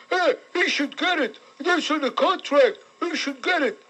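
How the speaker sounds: mock the gavones' refrain in a high silly voice; mocking dumb fan. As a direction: ape the callers in a high dopey voice. Host mocks callers' whiny refrain.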